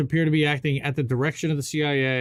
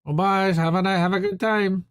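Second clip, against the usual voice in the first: high, bright mock farewell; mock cheery goodbye; 7 semitones higher, 4 dB louder than usual. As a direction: wave them off with fake cheer. Mimics the spy waving goodbye.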